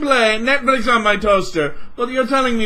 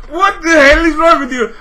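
louder, higher, breaking into laughter; laughing-crying yell; 5 semitones higher, 7 dB louder than usual. Real, not acted. Repeating the outburst at Microsoft, laughing yell. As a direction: yell it again, cracking up.

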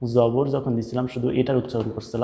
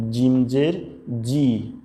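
ordinary talk

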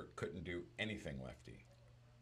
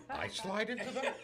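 much higher, incredulous mother, laughing through it; mama voice; 6 dB louder than usual. Retold or imitated. The mother shocked that Lou slides into bases, high and laughing. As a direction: squeak it out in disbelief. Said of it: "She goes".

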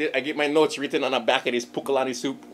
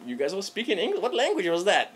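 higher, puzzled mock question; a friend who can't follow Pidgin, mock bewildered; friend's baffled question; 8 semitones higher than usual. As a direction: imitate a confused friend asking. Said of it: "he was like".

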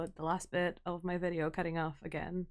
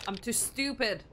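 ordinary talk

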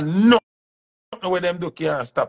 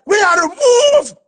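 much higher, much louder scolding yell; aggressive shout; 5 dB louder, slower than usual. Shown as a demonstration. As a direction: scare the baby off the kettle. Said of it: "in an aggressive tone".

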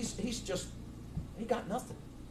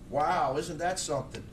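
ordinary talk

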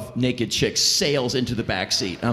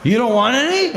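put-on drunk offer; 10 semitones higher, 4 dB louder than usual. Drunk drivers offering whiskey.

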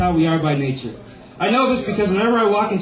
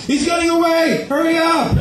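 high urgent shout; 9 semitones higher than usual. Pretend. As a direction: yell after a runaway. Cops chasing down sidewalk.

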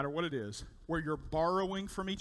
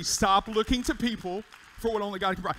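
the key line again, louder and ringing; loud emphatic repeat; 4 semitones higher, 7 dB louder than usual. Shown as a demonstration. Pastor repeating the first key to the church, louder. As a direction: hammer it home for the congregation. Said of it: "That's the first key".

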